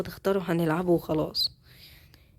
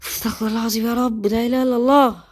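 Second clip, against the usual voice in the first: exasperated exclamation; 4 semitones higher, 5 dB louder than usual. Real, not acted. Frustration over missing iron.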